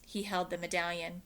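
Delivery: ordinary delivery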